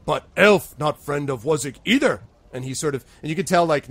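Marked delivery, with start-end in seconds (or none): 0.1-2.2 loud troll objection, pitched up; indignant troll. Acted. Troll objecting to elves as well, loud and indignant. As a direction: push it out loudly, leaning on the contrast.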